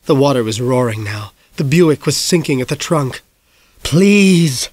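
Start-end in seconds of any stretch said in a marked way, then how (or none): 3.8-4.7 high, pleading, anguished; begging. Acted. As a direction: beg with everything you have. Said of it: "Dad begged".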